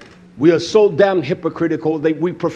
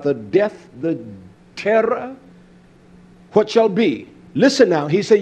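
ordinary talk, nothing marked

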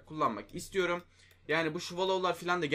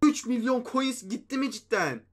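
shocked disbelief; 7 semitones higher, 5 dB louder than usual. Real, not acted. Shocked at losing coins.

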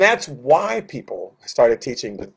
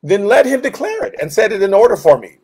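higher, louder preaching of scripture; fiery preaching; 4 semitones higher, 4 dB louder than usual. Acted. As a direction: proclaim it with force from the pulpit. Preaching scripture at full force.

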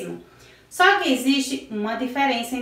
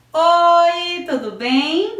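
bright greeting; 6 semitones higher, 5 dB louder, much slower than usual. Real, not acted.

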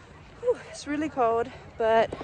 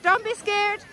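loud, high encouraging cheer; excited cheering; 11 semitones higher, 4 dB louder than usual. Real, not acted. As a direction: cheer her down the hill. Cheering daughter down the hill.

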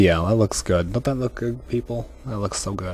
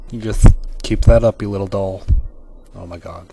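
normal talking voice

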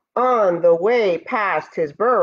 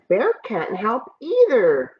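ordinary delivery, nothing marked

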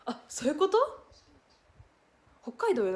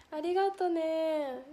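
usual speaking voice

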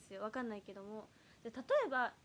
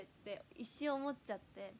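usual speaking voice

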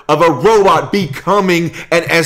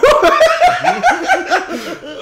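high burst of laughter. Real, not acted. Laughing hard at cohost's joke. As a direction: crack up at the tip joke.

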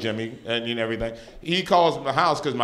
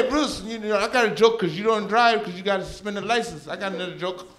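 mom voice; 7 semitones higher than usual. Retold or imitated. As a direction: tease him like a tipsy mother. Mom calling after son.

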